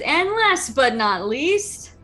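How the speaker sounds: showy ringmaster lead-in; arena announcer. Acted. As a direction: draw it out to build suspense. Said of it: "then the announcer says".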